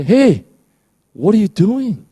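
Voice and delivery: high, loud, playful call-out; a friendly voice catching you in the act; high teasing "hey, what are you doing?"; Holy Spirit nudging. Pretend. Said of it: "you hear the Holy Spirit say".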